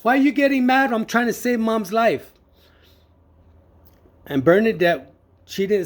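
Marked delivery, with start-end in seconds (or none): 0.0-2.3 high-pitched, retold protest; indignant retort. Son answering his angry sister at the hospital, indignant protest. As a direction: argue back, pitched well up. Arguing back at mad sister.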